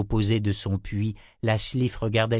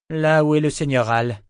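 firm answer; 6 semitones higher, 6 dB louder than usual. A narrator's reading. Kara answers the creature.

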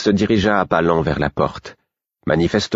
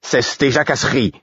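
curt, higher-pitched rebuke; ton sec; 7 semitones higher than usual. A narrator's reading. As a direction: cut the fool short sharply. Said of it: "dit sèchement Murfess". (réprimande sèche et agacée)

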